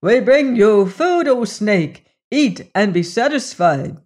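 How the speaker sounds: cried aloud. A narrator's reading. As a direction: proclaim the offering to the god. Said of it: "cried aloud".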